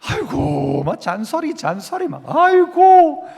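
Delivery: groaning complaint with drawn-out sighing "aigo"; mock spousal grumbling. Spouse complaining about the other's nagging, mock exasperated grumbling. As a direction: grumble in exasperation, stretching the wails. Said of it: "아내들이 남편 이야기".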